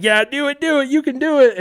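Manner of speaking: high, loud cheering; rowdy egging-on. Acted. Older buddies cheer the kid toward danger, loud high goading. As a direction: whoop him on like a pack of buddies.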